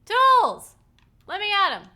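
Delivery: high, loud triumphant cry; excited shout. Real, not acted. Player finding the tools, shouting with joy. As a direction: cheer like you just found treasure.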